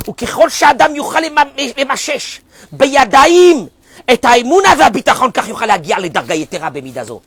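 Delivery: loud, high chanted reading of the source; fervent Hebrew reading. Acted. Rabbi reading Hebrew text to his class, loud and fervent.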